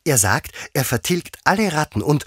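high-pitched, enthusiastic gushing; eager Alice, carried away in a high voice; eager chattering. A narrator's reading. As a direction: rattle on keenly about a wonderful dog. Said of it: "daher fuhr Alice eifrig fort".

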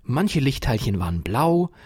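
ordinary delivery

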